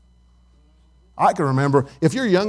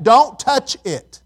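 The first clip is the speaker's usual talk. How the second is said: "Don't touch it" stretched out slowly, each word weighted; slow drawn-out warning; 8 semitones higher, 5 dB louder, much slower than usual. Shown as a demonstration. Preacher repeating God's command to the congregation, drawn out slowly. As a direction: slowly lean on every word.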